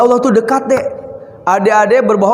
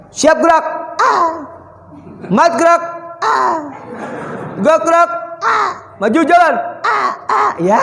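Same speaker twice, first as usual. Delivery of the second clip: high barked drill sergeant calls; drill commands; 8 semitones higher than usual. Acted. Preacher mimics army drill to children, barking high commands. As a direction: shout the marching orders like a soldier.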